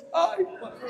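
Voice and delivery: high exasperated groan; exasperated sigh. Real, not acted. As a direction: roll your eyes at such naivety. Reage à ingenuidade. (suspiro exasperado e agudo)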